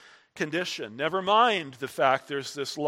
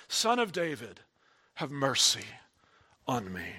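plea that fades to a soft murmur; softened plea; 7 semitones lower, 6 dB quieter than usual. Acted. Preacher echoing the blind man's plea, fading soft. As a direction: let the cry sink to almost nothing.

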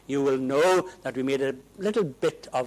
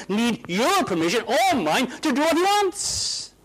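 raised, loud, incredulous challenge; indignant retort; 8 semitones higher, 6 dB louder than usual. Retold or imitated. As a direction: demand it in disbelief. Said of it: "I said".

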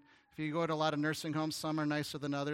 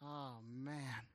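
reluctant groan; 3 semitones lower, 11 dB quieter than usual. Shown as a demonstration. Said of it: "you know how a lot of us feel?".

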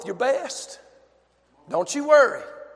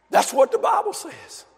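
raised, driving sermon voice; fervent preaching; 9 semitones higher, 9 dB louder than usual. Real, not acted. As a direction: preach it with fire. Preaching at full force.

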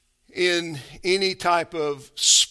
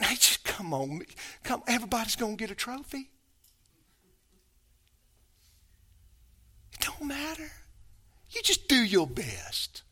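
a light, softer mock of a breezy coach; mocking casual voice; 4 semitones higher, 8 dB quieter than usual. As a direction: toss it off as a lazy pep talk, lightly mocked. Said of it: "Jesus isn't saying".

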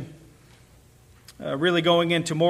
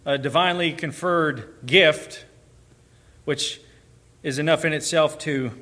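ordinary delivery

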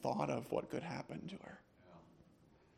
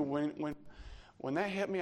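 usual speaking voice

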